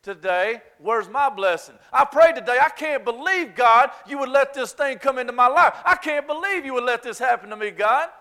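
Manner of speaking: loud, high, aggrieved complaint to God; mock sulky believer crying out to God; entitled whining. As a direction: whine at God that you deserve better. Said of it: "it will look like this".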